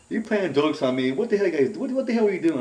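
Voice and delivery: high, loud, exasperated imitation; retold angry accusation. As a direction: act out a friend demanding to know who pranked him. Retold friend accusing them of pranks.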